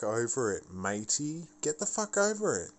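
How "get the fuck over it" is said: higher, faster repeat. Real, not acted. Ranting at friends, the repeat higher and faster. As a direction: Get the fuck over it, pitched much higher and rushed.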